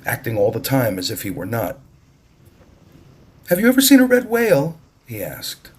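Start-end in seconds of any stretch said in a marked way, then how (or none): 3.5-4.8 put-on high, quick, eager boy's voice; a small boy bursting with curiosity; eager little boy. Little brother Ramo asks excitedly.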